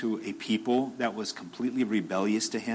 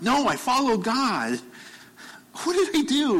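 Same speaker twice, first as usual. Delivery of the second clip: confused pleading; 12 semitones higher than usual. Retold or imitated. Confused Job pleading with God about his suffering. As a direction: plead with God, lost and hurt. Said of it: "he was like".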